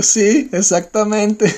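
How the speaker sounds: high, drawn-out, tickled confirmation; retold laughing agreement. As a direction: stretch it out, about to burst laughing. Retelling her own uncontrollable laughing reply. (asentir muerta de risa, agudo y alargado)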